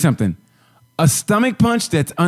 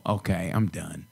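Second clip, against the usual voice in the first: deflated; 8 semitones lower, 11 dB quieter, slower than usual. Retold or imitated. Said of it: "I go".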